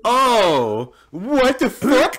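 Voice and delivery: drawn-out laughing what the; retold shock.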